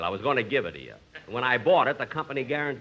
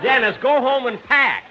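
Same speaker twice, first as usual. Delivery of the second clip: loud, higher exasperated command; exasperated order; 7 semitones higher, 8 dB louder than usual. Acted. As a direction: lose patience and order him out.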